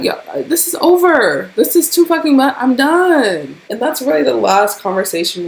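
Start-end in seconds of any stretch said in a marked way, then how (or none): 0.0-3.6 higher, louder exasperated cry; loud fed-up quote; fed-up outburst. Retold or imitated. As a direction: throw your hands up, done with it all. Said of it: "I'm like".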